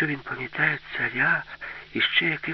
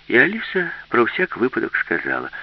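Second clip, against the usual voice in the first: ordinary delivery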